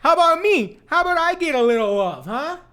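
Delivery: loud, high New York voice; brash New York guy asking for love; New York accent. Pretend. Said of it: "That's my New York accent".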